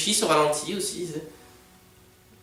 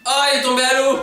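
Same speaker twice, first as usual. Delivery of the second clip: excited; 7 semitones higher, 6 dB louder, faster than usual. Real, not acted. Reacting to scene on screen.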